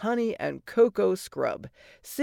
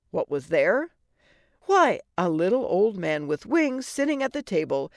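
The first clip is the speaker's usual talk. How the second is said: usual speaking voice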